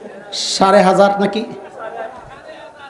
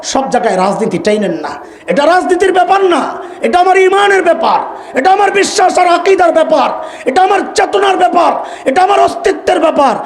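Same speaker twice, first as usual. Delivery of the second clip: fiery preaching; 5 semitones higher than usual. Real, not acted. Preacher rousing the gathering against critics, fiery preaching. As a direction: drive each phrase home to the crowd. Preacher rises to full force.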